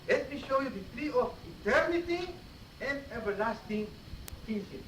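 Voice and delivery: usual speaking voice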